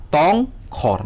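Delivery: "tongkhor" slowly split as tong, khor; syllable by syllable. Shown as a demonstration. Teacher showing students the syllables, "tongkhor" split in two. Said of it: "Kokthor wngkha syllable".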